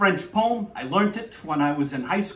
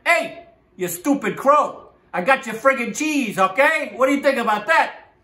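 higher, cocky gloating; taunting fox; 6 semitones higher, 3 dB louder than usual. Acted. Fox who grabbed the cheese to the crow, gloating. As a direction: gloat and taunt in a raised pitch. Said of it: "And he's like, [fox]".

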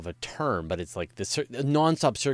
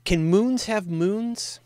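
put-on silly childlike question; 12 semitones higher, 6 dB louder, much slower than usual. Host asks playful silly question.

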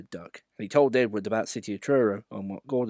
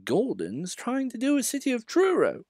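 put-on much higher amused old engine; chuckling Edward; 13 semitones higher than usual. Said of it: "Edward chuckled".

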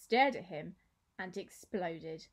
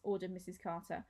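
usual speaking voice

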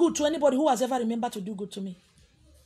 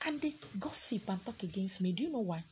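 whisper; 5 semitones lower, 9 dB quieter than usual. Real, not acted. Confiding aside about gossip.

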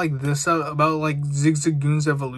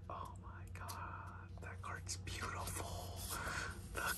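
breathless hushed whisper of admiration; awed whisper; 23 dB quieter than usual. Real, not acted. Collector marveling at a beautiful card to viewers, whispered awe.

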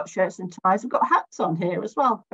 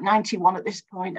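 ordinary talk

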